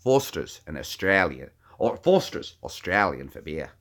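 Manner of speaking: put-on Aussie accent; Australian accent. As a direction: say it in a laid-back Australian accent. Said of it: "Can you say Foster's?".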